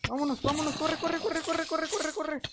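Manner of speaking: high, rapid urging to run; frantic run call; urgent. Real, not acted. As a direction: hurry your friend along. Urging friend to run away.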